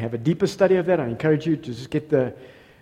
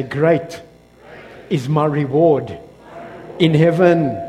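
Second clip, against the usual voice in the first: slow, louder with each phrase, for the church to echo; slow call-and-response; 5 dB louder, slower than usual. Shown as a demonstration. Pastor leading the church to repeat the verse, slow and rising in volume. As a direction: lead the congregation line by line. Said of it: "Everybody say".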